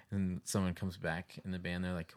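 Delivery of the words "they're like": normal talking voice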